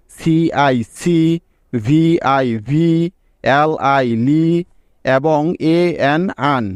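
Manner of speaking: very slow, each letter apart, then the syllable; slow syllable spelling. Shown as a demonstration. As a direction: spell it out slowly for learners. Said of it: "কি কি syllable পেলাম". (ধীরে ধীরে অক্ষর ভেঙে বলা)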